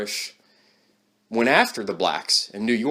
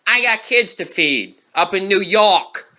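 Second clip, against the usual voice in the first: loud, high mock Italian accent; 8 semitones higher, 6 dB louder than usual. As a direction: jeer in a put-on Italian accent. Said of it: "your stupid Italian accent".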